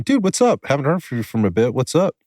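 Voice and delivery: high-pitched, casual phone hello; upbeat retold greeting. Retelling a Saturday night call from a buddy, upbeat greeting. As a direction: toss out a lively "checking in" greeting.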